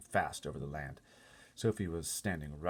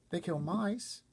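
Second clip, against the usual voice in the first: put-on higher, small girl voice; little girl Sophie; 13 semitones higher, much slower than usual. Sophie asking the giant about the other giants, small high girl voice. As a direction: speak up like a worried little girl.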